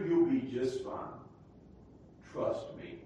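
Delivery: normal talking voice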